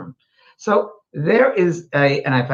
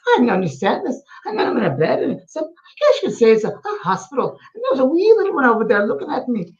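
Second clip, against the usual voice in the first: Scottish woman; 10 semitones higher than usual. Retold or imitated. Medium retelling a Scottish spirit, high Scottish lady voice. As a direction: play the old Scottish woman, bemused. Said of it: "it was a Scottish woman".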